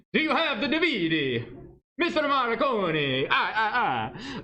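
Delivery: high, drawn-out sing-song Italian caricature; hammed-up Italian with laughing "ah, ah, ah"; mock Italian accent. Teacher clowning with switched DVD stress, mock Italian accent. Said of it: "you instantly become Italian".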